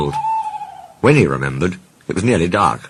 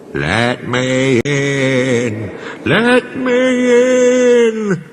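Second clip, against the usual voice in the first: very slow, sing-song ghost wail, far higher; spooky voice; 8 semitones higher, 3 dB louder, much slower than usual. A narrator's reading. Percy pretending to be a ghost at the shed door, spooky wail. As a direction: moan it out like a ghost. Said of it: "said Percy in a spooky voice".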